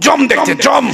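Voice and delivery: father-in-law snapping at the son; mock furious shout; angry old man. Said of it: "শ্বশুর আরো রেগে গেছে".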